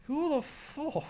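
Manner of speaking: high, loud, slow exclamation; a swear cut off mid-word; loud outburst. Real, not acted. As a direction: blurt it out before catching yourself.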